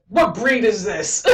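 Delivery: slow, imitated demand; mock-appalled gunslinger. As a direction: slowly voice a baffled hero, amused.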